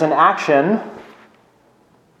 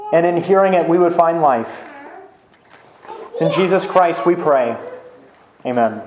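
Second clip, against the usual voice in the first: prayer. Real, not acted. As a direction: ask God for help, sincerely, without rushing. Pastor leads prayer after reading.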